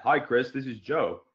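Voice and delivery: a light imitation of cousin Joe, a different timbre; cousin Joe's voice. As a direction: give a hint of another man's voice coming out of you. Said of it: "it came out of my mouth just like that".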